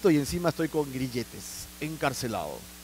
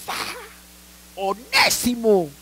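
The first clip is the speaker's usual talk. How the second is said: stunned gasp; 4 semitones higher, much slower than usual. Acted. Philemon shocked reading name.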